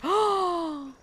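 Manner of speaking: sharp, high gasp of delight; excited gasp. Real, not acted. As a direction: gasp high at a sudden win. Sudden game catch while streaming.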